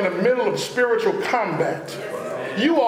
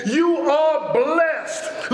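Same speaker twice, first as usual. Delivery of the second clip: slow, higher declaration of blessing; drawn-out, uplifting encouragement; encouraging praise; 4 semitones higher, much slower than usual. Retold or imitated. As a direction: proclaim it slowly as praise. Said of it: "an offer of encouragement and praise".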